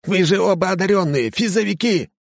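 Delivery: high hysterical yell. Acted. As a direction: shriek in disbelief. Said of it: "истерические нотки".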